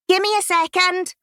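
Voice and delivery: yelled back. Retold or imitated. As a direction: shout it back toward the shop. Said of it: "I yelled back".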